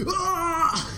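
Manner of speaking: high-pitched imitation scream; mock scream. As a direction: let out a sudden high mock shriek. Imitates Khonshu screaming at Steven.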